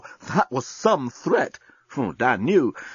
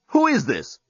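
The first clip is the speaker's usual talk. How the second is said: indignant; 7 semitones higher than usual. Acted. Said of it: "indignation mode".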